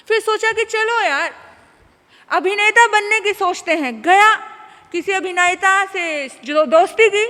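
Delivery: booming preacher's warning; forceful preaching. Acted. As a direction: drive the lesson home.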